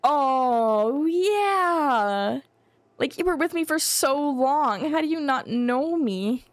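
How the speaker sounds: playfully wounded, swooping pitch; mock heartbreak. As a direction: plead like a jilted lover, hammed up. Mock-hurt scene with game character.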